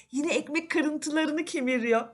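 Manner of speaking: usual speaking voice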